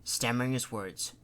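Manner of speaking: plain reading voice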